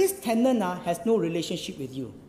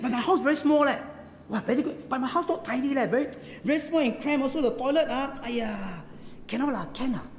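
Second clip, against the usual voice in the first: higher, embarrassed apologies tumbling out; flustered humble host; 6 semitones higher than usual. Retold or imitated. Host telling the prime minister his house is cramped, flustered and apologetic. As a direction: fuss that your little flat is too small. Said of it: "He said".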